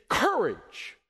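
COURAGE punched high and drawn out; one ringing, high-pitched command; commanding cry. Shown as a demonstration. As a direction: proclaim COURAGE as an order. One-word command, corrected reading.

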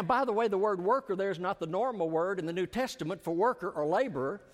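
ordinary talk

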